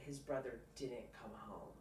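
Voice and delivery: usual speaking voice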